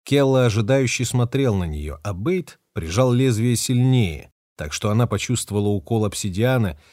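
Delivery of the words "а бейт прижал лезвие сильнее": usual speaking voice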